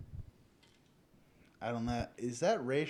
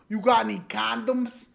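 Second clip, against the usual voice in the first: loud, higher eager question; restaurant customer asking; 7 semitones higher, 5 dB louder than usual. Acted. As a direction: ask the server brashly.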